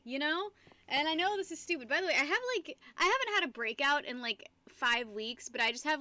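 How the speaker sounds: normal talking voice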